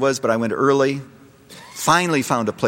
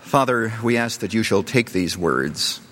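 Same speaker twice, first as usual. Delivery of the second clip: closing prayer. Acted. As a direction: pray gently over the listeners.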